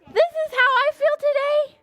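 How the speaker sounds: high, gasping delight; excited happy. Pretend. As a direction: show children a happy feeling, thrilled.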